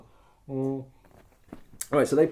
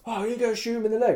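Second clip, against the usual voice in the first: urging; 9 semitones higher, faster than usual. Retold or imitated. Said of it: "And I'm like".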